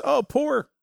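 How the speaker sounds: high, pitying mock voice; imitating Jessica's pity. Father imitating daughter pitying a roadkill animal. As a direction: coo it with sorrow. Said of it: "Sometimes it's".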